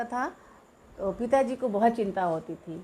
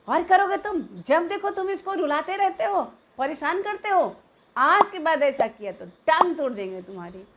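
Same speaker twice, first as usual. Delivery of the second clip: high, loud telling-off; angry scolding; 6 semitones higher, 3 dB louder than usual. Retold or imitated. Elder brother to the naughty middle brother, angry high scolding. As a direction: threaten him so he never does it again.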